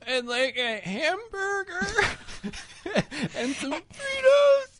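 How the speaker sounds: very high, slow, sobbing wail; sobbing while ordering a hamburger; crying through it. Pretend. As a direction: blubber ordinary words. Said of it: "crying and then saying normal things".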